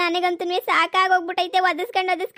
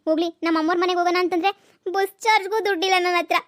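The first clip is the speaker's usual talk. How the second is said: usual speaking voice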